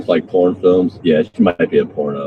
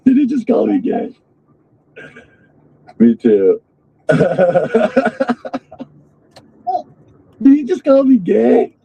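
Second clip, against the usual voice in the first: mocking girly voice. Mocks the streamer's high voice.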